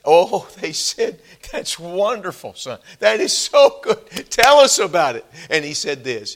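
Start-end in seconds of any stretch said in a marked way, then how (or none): 0.0-5.2 delighted parents. Retold or imitated. Parents praising their newly saved son, excited. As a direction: gush over the son.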